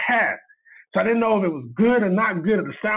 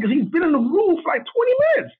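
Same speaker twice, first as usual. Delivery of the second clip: very high-pitched retold alarm; freaking out; 12 semitones higher, 3 dB louder than usual. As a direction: relive the panic, voice shooting up. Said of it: "I'm freaking out".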